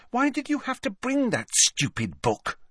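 high-pitched exasperated reproach; high, fed-up complaint; exasperated scolding. A narrator's reading. Friend scolds after ban threat.

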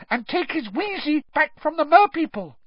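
very high squeaky elf; high-pitched insistent elf voice; Dobby. A narrator's reading. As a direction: squeak it urgently. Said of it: "squeaked the elf".

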